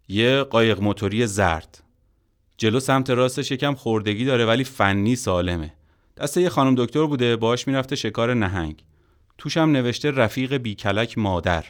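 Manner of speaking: mock him with a made-up description; loud sneering joke at his expense; sarcastic mockery. Man sarcastically describes envelope contents.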